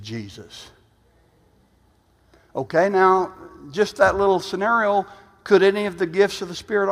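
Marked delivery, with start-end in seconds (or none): 0.0-0.7 much softer, low and hushed; a reverent near-murmur; hushed reverent answer. Retold or imitated. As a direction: let the name fall quietly.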